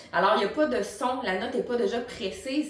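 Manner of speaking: ordinary delivery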